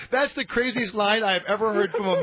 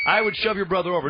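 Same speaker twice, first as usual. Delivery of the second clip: normal talking voice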